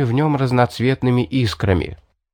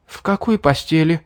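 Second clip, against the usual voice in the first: hoarse; 7 semitones higher than usual. Acted. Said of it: "хрипло переспросила Мэри".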